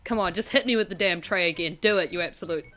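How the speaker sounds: ordinary talk, nothing marked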